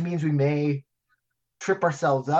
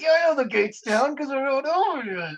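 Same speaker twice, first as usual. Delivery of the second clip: mock bartender; 8 semitones higher than usual. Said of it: "And I was like".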